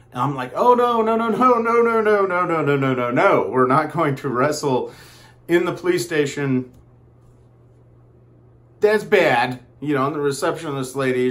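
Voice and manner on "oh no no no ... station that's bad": much higher and louder, easing down to firm refusal; panicked stream of no's, settling into a scolding; alarmed no no. Retold or imitated. Stopping a wrestling bout in the station.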